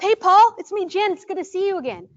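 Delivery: put-on bound up to the kid too eagerly; eager rush-up greeting. Shown as a demonstration. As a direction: overeager hello to a wary child. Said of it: "would you come rushing up?".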